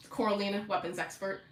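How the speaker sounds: ordinary talk, nothing marked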